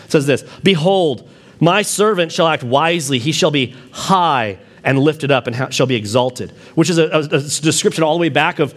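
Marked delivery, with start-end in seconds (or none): 0.6-6.5 scripture reading. Acted. Pastor reads Isaiah aloud.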